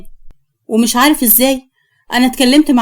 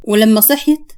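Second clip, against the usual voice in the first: ordinary delivery, nothing marked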